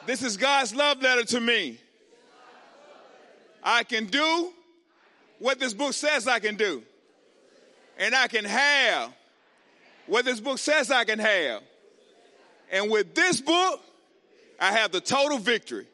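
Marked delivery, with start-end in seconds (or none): 0.0-11.6 loud, firm group declaration; rally the congregation with a loud declaration; louder declaration. Shown as a demonstration. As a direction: lead the crowd with more push. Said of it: "y'all can do better than that".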